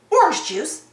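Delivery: ordinary delivery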